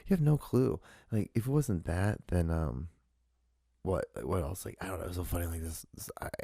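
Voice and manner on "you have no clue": high-pitched shut the fuck up; angry comeback. Retold or imitated. Telling off commenters who judged him, high angry comeback. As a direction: tell them off, exasperated. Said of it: "I'm like".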